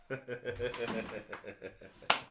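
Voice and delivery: soft amused laugh; a quiet laugh at his own idea; real laugh. Real, not acted. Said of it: "The reason why I'm laughing".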